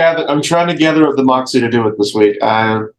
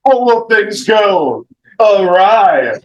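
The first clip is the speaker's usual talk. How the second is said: higher, dramatic trailer narrator; trailer announcer; 10 semitones higher than usual. Acted. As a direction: hype up the next episode like a movie trailer. Teaser announcement for the show.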